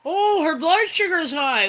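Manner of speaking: high mock doctor voice. Mocking the doctor to her viewers, high mock voice. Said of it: "the doctor's looking at my thing".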